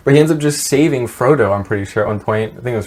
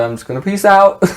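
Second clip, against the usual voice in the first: "I'm just gonna peace out" said higher and brighter; high playful repeat; 8 semitones higher than usual. Retold or imitated.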